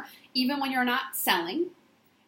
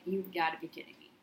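low and quiet, can't believe it; hushed, disbelieving murmur; mock-stunned under her breath; stunned disbelief; 4 semitones lower, 6 dB quieter than usual. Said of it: "And I was like".

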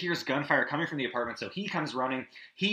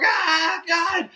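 high loud anguished cry, hammed-up; mock anguished yell; 8 dB louder than usual. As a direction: yell out in pain like the audience. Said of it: "Everyone's just like".

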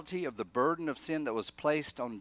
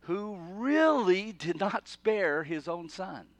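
really lifted high, slow and louder, the whole line pressed; stress on really; 6 semitones higher, 5 dB louder, slower than usual. Shown as a demonstration. Preacher to congregation, stressing really with slow weight. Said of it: "the best I could do is".

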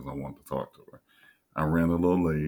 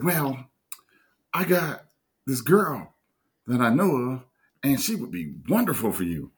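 higher, louder matchmaker voice; mimicking Lisa; 9 semitones higher, 5 dB louder than usual. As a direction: sell him on the girl in her voice. Imitating Lisa pitching a girl.

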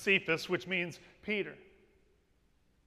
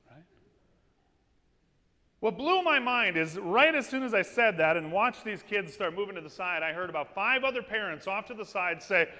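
usual speaking voice

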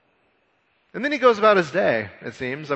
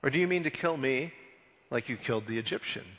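quiet, understated retort, fading softer; putting someone in place; 8 dB quieter than usual. Retold or imitated. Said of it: "That's called putting somebody in their place".

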